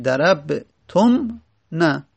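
ضربتم نه said very slowly, syllable by syllable; spelled out slowly. Shown as a demonstration. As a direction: lay out ضربتم نه slowly for students. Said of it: "اصلش چی بوده؟". (ضربتم نه آهسته و شمرده)

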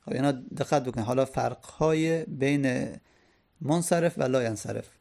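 ordinary talk

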